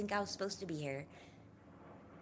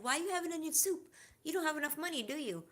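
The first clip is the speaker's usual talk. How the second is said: higher, mocking imitation of the date; date's question; 10 semitones higher, faster than usual. Retelling the movie date questioning his soup, mock higher voice. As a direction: lightly voice the date's pointed question. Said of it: "And she said".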